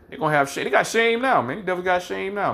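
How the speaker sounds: fired-up mocking preacher; heated preaching. Real, not acted. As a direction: push it up high and hot. Preacher voice climbs high.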